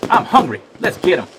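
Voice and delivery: high, loud eager call; hungry cowboy. A narrator's reading. Cowboy rallying to catch the cookie, high and loud. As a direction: go for him, greedy.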